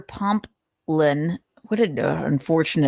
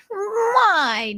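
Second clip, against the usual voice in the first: very high squeaky why; Nick impression; 13 semitones higher than usual. Narrator voicing Nick's protest, very high. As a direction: squeak it like a whiny man. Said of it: "Nick's impression".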